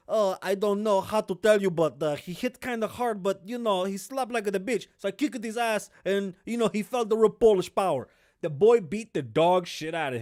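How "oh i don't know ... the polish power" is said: mock fighter. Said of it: "He goes".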